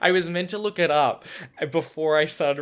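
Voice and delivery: high, loud embarrassed laughter; laughing. Real, not acted. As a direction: laugh at yourself apologetically. Laughing at own mistake.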